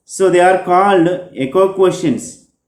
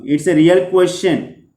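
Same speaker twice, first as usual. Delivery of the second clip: ordinary delivery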